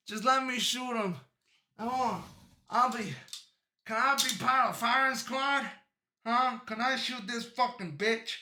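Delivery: rowdy hollering at the officer; loud gleeful demand. Real, not acted. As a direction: beg loudly to be the shooter. Demanding to join firing squad.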